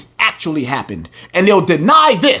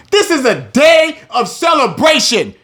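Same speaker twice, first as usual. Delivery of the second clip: preaching shout; 7 semitones higher than usual. Real, not acted. Preacher shouting to his listeners that this is a day of celebration. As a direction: belt it out, rising in pitch and volume.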